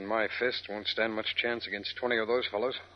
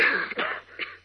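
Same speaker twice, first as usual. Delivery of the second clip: choking cough; 7 semitones higher than usual. Acted. Choking on smoke.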